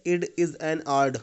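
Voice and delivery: slow German accent, "It is an art" with D for T; drawn-out German accent, every T turned to D; teacher demonstrating German accent. Shown as a demonstration. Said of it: "हमेशा टी की जगह पे डी बोलते थे".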